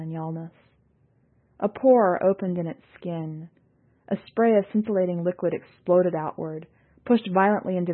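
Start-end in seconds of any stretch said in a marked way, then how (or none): none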